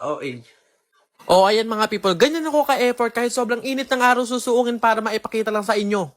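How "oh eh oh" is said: normal talking voice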